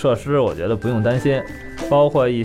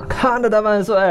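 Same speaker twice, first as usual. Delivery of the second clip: put-on high excited kids' shout; 10 semitones higher than usual. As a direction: cheer it out like a thrilled kid. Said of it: "俩人就喊".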